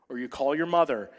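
ordinary talk, nothing marked

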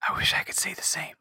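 quick whispered quip. Real, not acted. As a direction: mutter it under your breath. GM whispered aside.

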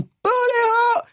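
very high-pitched, drawn-out mimicked scream; imitated girl's scream, stretched out high; mock scream. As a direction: squeal it slowly like a screaming girl. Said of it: "a girl keeps screaming".

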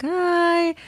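put-on high sweet baby hi; baby's happy hi. Mom imitating her baby's happy high hi. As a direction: squeak it like a beaming toddler.

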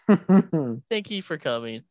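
booming slow sign-off to listeners; loud slow host voice. Shown as a demonstration. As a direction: announce it like a show host. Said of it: "our topic has gone so off".

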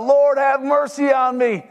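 high, drawn-out wailing plea; crying out. Acted. Man begs the Lord after his wife sinks, wailing. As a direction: wail it slowly up to heaven. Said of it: "And he cries out".

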